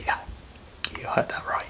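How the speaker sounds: very soft, almost whispered; low-volume, whispery assurance; hushed confiding. Acted. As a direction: confide it under your breath. Confiding the unbelievable to listener.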